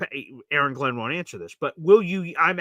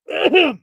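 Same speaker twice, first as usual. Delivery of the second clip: sharp mock-emotional throat clear; 12 semitones higher, 8 dB louder than usual. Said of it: "it gets me emotional".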